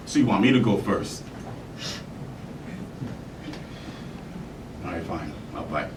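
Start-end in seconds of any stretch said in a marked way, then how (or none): 4.9-5.9 low, soft and resigned; grudging acceptance. Acted. Actor agreeing to go first, grudging. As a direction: give in quietly. Reluctantly answering in monologue.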